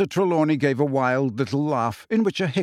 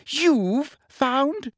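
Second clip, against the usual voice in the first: shriller repeat; 12 semitones higher than usual. A narrator's reading. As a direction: shriek it in disbelief. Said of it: "said Umbridge shrilly".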